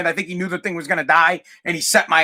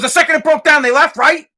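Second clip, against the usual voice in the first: higher, louder indignant challenge; pissed-off father; 7 semitones higher, 4 dB louder than usual. Retold or imitated. Father confirming the friends left at once, pissed off. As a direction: imitate an angry dad pressing his point. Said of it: "he was pissed".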